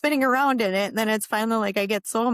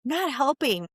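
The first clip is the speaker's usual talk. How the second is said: a hammed-up irritated nag; mock angry complaint; 5 semitones higher than usual. Wife to husband on the kayak, mock angry complaint. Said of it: "I was super mad".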